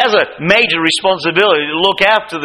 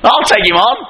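higher, bold challenging voice; bold young David; 8 semitones higher than usual. Retold or imitated. Preacher voicing young David defying Goliath, higher and bold. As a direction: rise up and dare the giant. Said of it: "little brother stands up and says".

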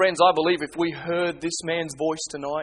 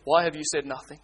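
normal talking voice

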